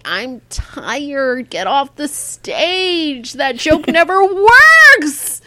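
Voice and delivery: burnt-out comic. Retold or imitated. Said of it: "And then you're like".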